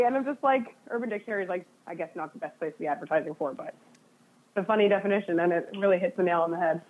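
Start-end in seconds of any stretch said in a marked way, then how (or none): none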